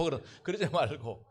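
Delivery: normal talking voice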